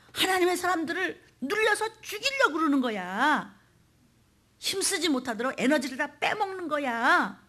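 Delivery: expose the enemy to the congregation; impassioned preaching. Real, not acted. Preaches with force. (높은 어조로 외치는 설교)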